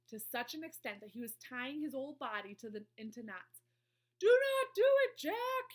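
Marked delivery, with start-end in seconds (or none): 4.2-5.7 high, very loud plea; screech. A narrator's reading. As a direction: screech in terror. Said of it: "he screeched".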